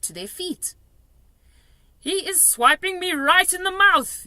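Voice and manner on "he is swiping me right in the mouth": loud, high angry yell. A narrator's reading. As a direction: yell in outrage. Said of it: "yelled the meat dripper".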